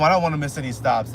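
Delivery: usual speaking voice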